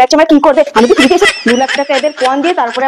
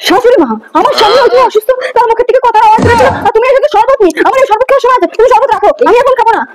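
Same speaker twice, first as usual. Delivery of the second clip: high-pitched, worked-up refusal; agitated protest; 8 semitones higher than usual. Acted. Daughter-in-law refusing mother-in-law's sherbet, high agitated protest. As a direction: push the sherbet away, voice up and distressed. Wife upset about husband losing voice.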